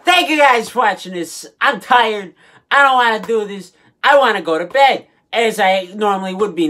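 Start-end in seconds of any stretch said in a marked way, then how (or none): none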